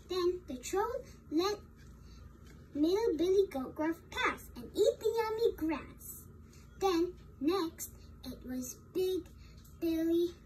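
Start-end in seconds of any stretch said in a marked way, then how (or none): none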